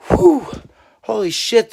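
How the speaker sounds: high, loud whoop of amazement; retold tipsy astonishment, swooping down from high; amazed exclamation. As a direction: act out being bowled over by the wind. Acting own drunken amazement at wind.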